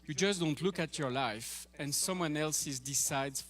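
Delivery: ordinary talk, nothing marked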